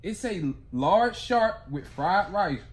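usual speaking voice

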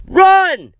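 very high, loud scream. Acted. Scott urges his friend to flee, screaming. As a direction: scream it right into his ear. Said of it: "he screamed in my ear".

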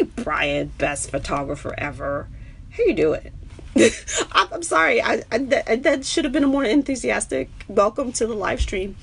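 ordinary talk

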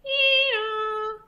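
high imitated police siren; siren wail. A narrator's reading. Cops come for the thieves, high siren wail. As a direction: wail like an arriving cop car.

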